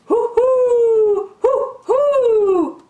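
high, loud, long hoots; high-pitched playful hooting; owl's hoot. Acted. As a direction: hoot like a storybook owl. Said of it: "asked the owl".

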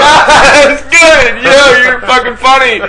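hammed-up fake amusement; fake laugh. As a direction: laugh along at an unfunny joke.

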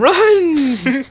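high excited yell of run; panicked call to flee; excited shout. Real, not acted. Fleeing police in game.

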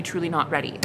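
normal talking voice

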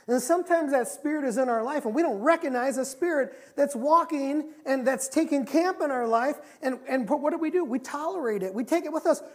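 loud, high, urgent sermon delivery; impassioned preaching. Real, not acted. Preacher warning about tolerating a spirit, impassioned and loud. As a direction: drive the point home to the congregation.

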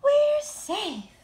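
high, loud relief; relieved. Acted. Stellaluna after the rescue, relieved. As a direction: breathe out with relief. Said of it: "said Stellaluna".

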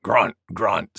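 low double grunt, spoken as words; grunting boar. A narrator's reading. Wild Boar joining the sneeze contest, grunting. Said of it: "said Wild Boar".

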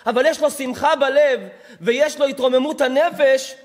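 loud, insistent preacher; impassioned preaching. Real, not acted. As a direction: drive each phrase home with force. Preaches at full force.

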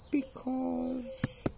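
very high, drawn-out mouse squeak; stalling mouse. Acted. Mouse stalling the hungry lion, high hesitant squeak. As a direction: hold the word high while thinking.

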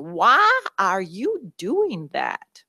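slow, drawn-out Southern question, imitating her coach; the coach gently puzzled, in a slow Southern drawl; Southern accent. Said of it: "And it was a Southern accent".

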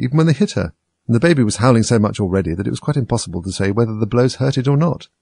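ordinary delivery

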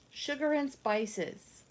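ordinary delivery, nothing marked

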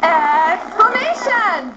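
slow, stretched excited chant; excited classroom exclamation; really excited. Shown as a demonstration. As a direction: draw the word out with excitement. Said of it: "say it really excited".

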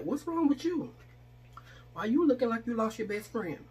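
a mock startled sister; concerned surprise. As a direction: jump in surprised and worried. Sister notices she is sad.

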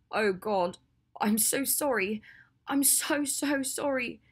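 high, drawn-out, stricken apology; anguished apology. Acted. Ginny sees her friend's stomach and apologizes, anguished.

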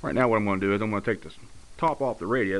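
ordinary talk

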